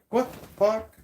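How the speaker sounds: high, loud startled outburst; startled reaction. Retold or imitated. Imagined stranger recoiling from the greeting, startled yelp. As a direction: jump back in shock. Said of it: "you'd be like".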